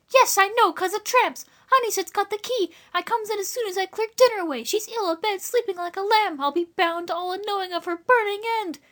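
very high, rustic woman's voice; worried country woman. A narrator's reading. Woman explains to the boys about Lily abed, high fretting.

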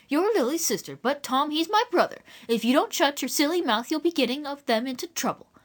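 mildly fierce woman. A narrator's reading. Neighbour warning Eliza about her brother Tom, mildly fierce scolding. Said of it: "the other woman fiercely said".

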